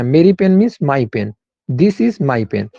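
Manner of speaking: very slow, word by word for the class; slow teacher's dictation. Shown as a demonstration. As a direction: spell it out slowly like dictating. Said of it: "teacher dictates slowly".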